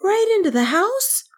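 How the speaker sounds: put-on very high, incredulous child; astonished question. A narrator's reading. Kay questions the Wind Boy, astonished. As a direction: echo it in high-pitched disbelief.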